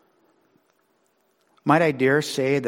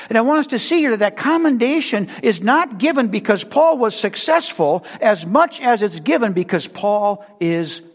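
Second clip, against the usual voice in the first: loud, high, driving sermon voice; full-force preaching; 9 semitones higher, 7 dB louder than usual. Acted. Pastor exhorting the congregation, loud and high. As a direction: preach it out to the whole church.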